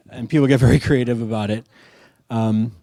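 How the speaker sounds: ordinary delivery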